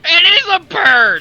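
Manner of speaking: high, loud, drawn-out exclamation; emphatic shout. Real, not acted. Game master defends his over-the-top bird voice, emphatic shout. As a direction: shout it in amused self-defence.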